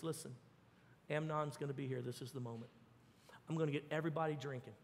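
low, quiet scheming order to his guards; hushed conspiring. Acted. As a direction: murmur the plot like a secret command. Absalom plots murder with bodyguards.

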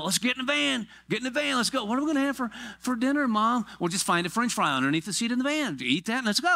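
hammed-up rushed parent and kid exchange; frantic pit stop parent. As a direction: herd the kids out the door. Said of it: "It's pit stop parenting".

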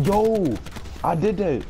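high excited yell; hyped after the play. Real, not acted.

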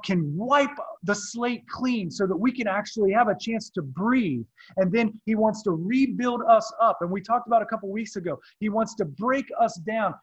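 loud, high fervent preaching. Acted. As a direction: preach it out with fire. Preaching at full force.

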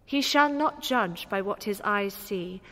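ordinary talk, nothing marked

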